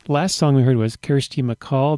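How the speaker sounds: ordinary talk, nothing marked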